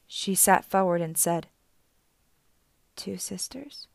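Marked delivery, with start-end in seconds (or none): 2.9-3.9 tense soft whisper. Acted. Annabeth whispering to Auntie Em in sudden suspicion. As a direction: barely breathe the question.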